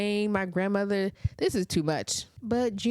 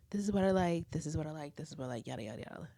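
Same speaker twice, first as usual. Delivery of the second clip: trailing off into a mumble, much softer; fading away; 4 semitones lower, 7 dB quieter, faster than usual. Retold or imitated. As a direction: let each repeat drop away softer. Repeated instruction fading away.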